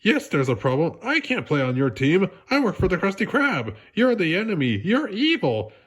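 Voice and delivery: protest, climbing in pitch; indignant sponge refusing the villain; sputtering. Acted.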